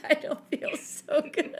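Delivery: high, slow mock complaint through laughter; laughing imitation. Friend retells sick woman's complaint at the beach, laughing. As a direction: retell a queasy groan while giggling.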